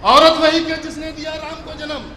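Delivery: loud declaiming to the crowd; stately nazm recitation. Acted. (بلند آواز میں نظم خوانی)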